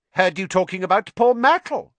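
high, loud mischievous needling; gleeful troublemaker stirring things up; taunting poltergeist. Acted.

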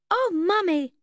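put-on high, loud child's cry; a small boy crying out in fright; frightened little boy. A narrator's reading. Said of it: "a little boy cried".

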